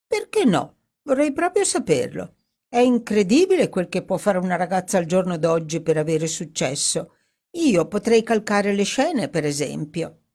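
high, protesting woman's voice; piqued opening, pitch jumping up; Dorothy, piqued. Acted. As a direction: retort indignantly, then settle into boasting.

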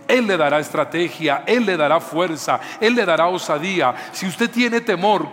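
predicar a todo pulmón, cada vez más fuerte; predicación enérgica. Acted. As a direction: clamar a la congregación con fuerza y pausas. Predicación a toda fuerza. (predicación fervorosa, alzando la voz)

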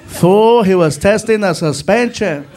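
loud, drawn-out smart remark; back-seat friend. Acted. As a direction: pipe up slowly and loudly from the back. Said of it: "from the back seat".

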